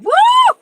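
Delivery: very high, very loud cheering whoop; excited whoop. Real, not acted. Host cheering Shawnee's line with a loud whoop. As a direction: let out a huge celebratory woo. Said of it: "I was like".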